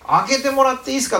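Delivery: high, loud and quick request; mock polite visitor. Jesus jokingly imagined asking to come in, high fast voice. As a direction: play it as a silly polite guest. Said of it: "とか言って入ってきたんじゃないって".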